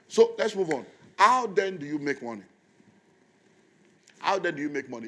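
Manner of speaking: preacher calling out a question to the congregation; preaching loudly. Real, not acted. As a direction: throw the question out to the church. Preacher raises new point.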